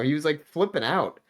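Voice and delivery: ordinary delivery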